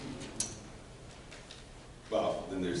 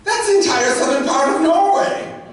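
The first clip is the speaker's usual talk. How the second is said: very high, loud incredulous outburst; hammed-up squawking objection; exasperated shout; 12 dB louder than usual. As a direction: yell it in comic disbelief. Favourite line, acted exasperated objection.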